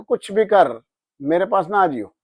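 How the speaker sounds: loud, high angry brush-off; angry dismissal. Retold or imitated. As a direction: cut them off in anger. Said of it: "मुझे बहुत तेज गुस्सा आ गया". (गुस्से में तेज़ आवाज़ से झिड़कना)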